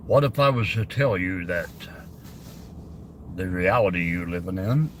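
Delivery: deep, soft movie-trailer announcer voice; Kmart announcer voice. Pretend. Streamer trying his deep Kmart voice for chat. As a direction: drop low and smooth like a store PA announcer. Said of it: "I always wanted to do the Kmart voice".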